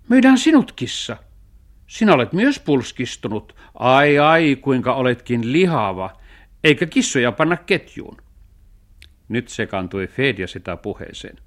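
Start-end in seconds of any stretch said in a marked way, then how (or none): 0.1-8.2 lightly voiced dog, high and loud; retorting dog. Dog proposes selling the cat instead, retorting. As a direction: turn the plan back on the cat.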